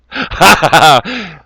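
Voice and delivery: loud burst of laughter; hearty laugh. Real, not acted. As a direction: let out a big laugh. Laughs at own joke.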